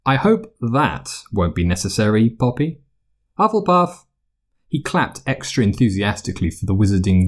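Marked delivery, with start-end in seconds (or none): 3.4-4.0 high, ringing announcement of the house; Sorting Hat call. A narrator's reading. The hat calling out the new student's house.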